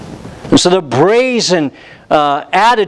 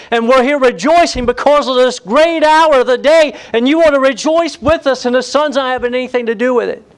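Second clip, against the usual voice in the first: pleading father; 7 semitones higher than usual. Acted. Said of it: "he begins to plead with the elder son".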